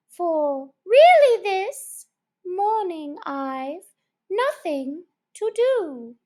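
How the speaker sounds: high, slow, menacing dog prosecutor; Fury the dog. Acted. Dog threatening the mouse with a trial, high and slow. Fury's tale verse voiced high.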